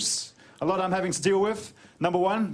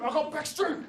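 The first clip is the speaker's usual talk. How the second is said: high mock comeback, a little softer; pitched-up silly fighting taunt; rival street fighter; 9 semitones higher, 4 dB quieter than usual. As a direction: imitate a brawler's answering threat. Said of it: "And the other dude".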